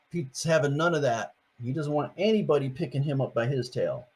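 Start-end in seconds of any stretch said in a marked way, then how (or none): none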